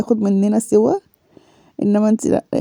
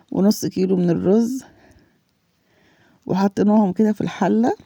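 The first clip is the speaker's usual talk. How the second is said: ordinary delivery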